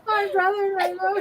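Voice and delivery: high, sobby imitation of a tearful speaker; exaggerated weepy wail, half laughing; mock crying. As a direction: imitate blubbering through your words, amused. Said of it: "she starts crying up there".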